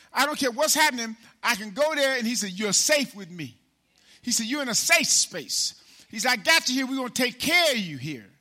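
loud, high preaching build; preaching at full force. Acted. Preacher rousing the congregation with a loud, high voice. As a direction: lift the church with every line.

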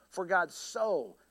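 "so" drawn out long after "For God"; stress on SO. Shown as a demonstration. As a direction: lean slowly on the word "so". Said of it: "I'd go to the second word".

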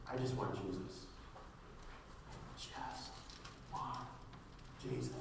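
soft, low, with long pauses between words; hushed simple plea. Acted. Believer simply tells God what he wants, hushed. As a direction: let each word drop quietly and stand alone. Models simple prayer, word by word.

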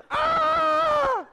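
long, very high loud scream; hammed-up terrified scream; scream of terror. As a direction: let out a sustained high-pitched shriek. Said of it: "They scream in terror".

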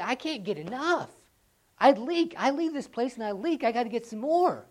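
loud, hungry voice of a revival believer; eager believer. Retold or imitated. Revival believers telling each other they can't get enough, loud and eager. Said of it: "they get together and they say".

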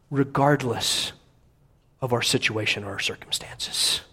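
voiceless hush; whisper. Real, not acted. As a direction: breathe it out in a whisper. Whispered close of a point.

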